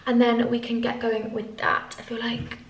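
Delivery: normal talking voice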